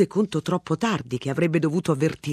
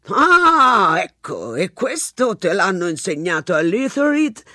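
a lightly voiced police sergeant, wry; a touch of gruff sergeant, faintly mocking; sergeant's remark; 2 semitones higher, 6 dB louder than usual. A narrator's reading. Sergeant teasing the young agent, lightly voiced. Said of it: "Disse il sergente".

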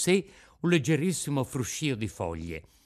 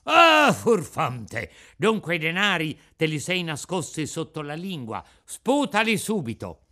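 furious shout; 3 semitones higher, 6 dB louder than usual. Acted. Bandit discovers hidden coins.

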